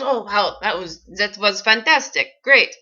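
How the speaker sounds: imitating a weird, sort-of-French accent; odd foreign accent, building to a loud finish; Tommy Wiseau. Director praising an auditioning actor, weird accent. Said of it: "weird accent, like it's sort of French".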